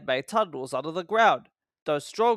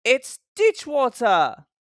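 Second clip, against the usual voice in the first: higher, louder, slow cheeky reveal; loud gleeful claim; 8 semitones higher, 7 dB louder, slower than usual. A narrator's reading. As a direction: savour the insult out loud. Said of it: "whispered Thomas loudly".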